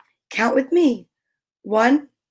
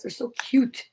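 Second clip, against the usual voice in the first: ordinary delivery, nothing marked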